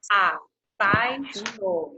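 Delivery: very slow, syllable by syllable. Shown as a demonstration. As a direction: Apaixonada stretched out so kids hear each syllable. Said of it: "ficam numa mesma sílaba".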